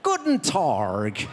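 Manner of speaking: German in a slow West Country drawl; West Country accent. Shown as a demonstration. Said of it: "German with a West Country accent".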